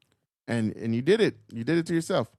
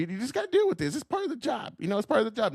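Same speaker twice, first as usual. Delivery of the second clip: mock excuse; 7 semitones higher, faster than usual. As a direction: parrot the excuse with scorn. Mocking the job excuse.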